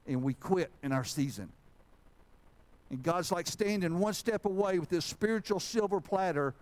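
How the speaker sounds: impassioned sermon delivery; fervent preaching. Real, not acted. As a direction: preach it out with force and high pitch. Preaches with force.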